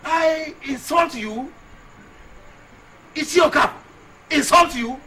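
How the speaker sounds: high, loud, incredulous questions; indignant outrage. Acted. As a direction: throw the accusation back at them, loudly. Indignant echo of accusation.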